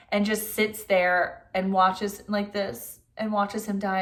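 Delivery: ordinary delivery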